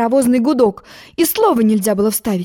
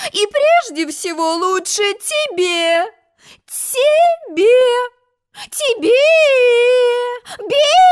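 building to a scream; much slower than usual. A narrator's reading. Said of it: "вдруг закричала она".

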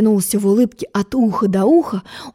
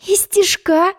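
modest reply; 10 semitones higher, slower than usual. A narrator's reading. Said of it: "скромно ответила".

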